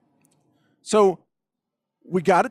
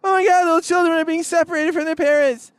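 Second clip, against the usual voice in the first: much higher, whiny mock panic; mock hysterical wail; 10 semitones higher than usual. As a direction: ham up a sobbing activist. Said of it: "screaming and crying and saying".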